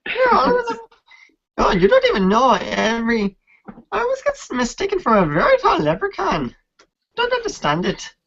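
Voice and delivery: high, playful Irish character voice; the Irish half-elf fighter, joking about leprechauns; Irish accent. Pretend.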